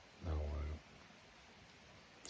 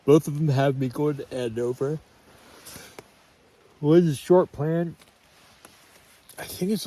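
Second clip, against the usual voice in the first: jolted voice while walking; on a bumpy road; 5 semitones higher, 6 dB louder than usual. Real, not acted. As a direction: talk while bouncing along the trail. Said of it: "sorry for the shaking".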